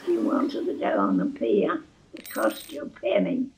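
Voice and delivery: ordinary delivery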